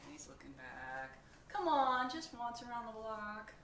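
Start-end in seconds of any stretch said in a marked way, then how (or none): 1.5-3.5 louder, coaxing pigeon; pleading pigeon. Acted. Pigeon coaxes the reader for one ride, pleading. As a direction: wheedle for one little ride.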